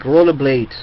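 ordinary delivery

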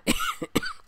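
sharp cough from a sore throat. Real, not acted. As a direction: cough mid-sentence. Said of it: "yes, I'm still coughing".

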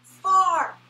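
high squeaky puppet call; Sesame Street far. Retold or imitated. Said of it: "This reminds me of an old Sesame Street skit".

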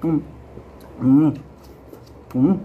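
talking with mouth full; speaking between juicy bites; chewing watermelon. Real, not acted. Seen 3: Chews watermelon with lips pursed, then bites more mid-phrase.

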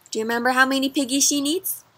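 ordinary delivery

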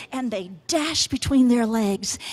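usual speaking voice

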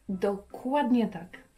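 ordinary delivery

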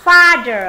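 "Father" with a loud, long AH. Shown as a demonstration. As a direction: stretch the first vowel of "Father". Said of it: "âm A dài nhá".

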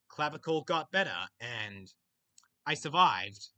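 ordinary talk

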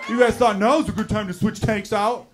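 loud, high, indignant rant; exasperated outburst. Acted. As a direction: yell at the crew backstage in exasperation. Mock outburst at stagehands over noise.

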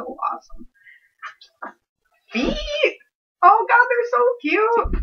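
very high, drawn-out squeal of delight; overwhelmed excited squeal. Real, not acted. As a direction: squeal as if about to cry from cuteness. Sees the fursuit feet, overwhelmed.

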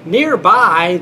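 ordinary talk

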